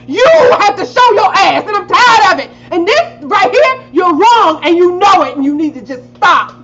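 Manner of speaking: furious rant. Retold or imitated. Daughter finally telling off her mother, loud and angry. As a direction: let it all out at mom. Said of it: "I'm like".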